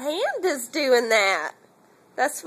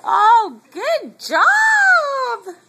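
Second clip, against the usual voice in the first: loud, much higher cheer; excited praise; 9 semitones higher, 8 dB louder, much slower than usual. Real, not acted. Mother praising her baby, loud and high. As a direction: burst out with praise.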